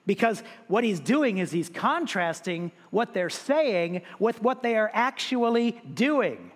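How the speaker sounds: ordinary delivery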